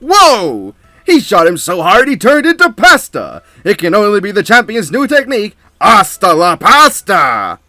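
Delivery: high, loud, excited fight commentator; hype announcer. Acted. Announcer commentating the tournament match, hyped and loud. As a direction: call it like a ringside announcer losing it.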